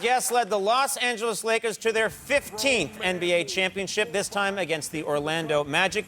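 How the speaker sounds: high, building announcer call; building to a shouted name; big guest introduction. Acted. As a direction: sell the entrance to the crowd.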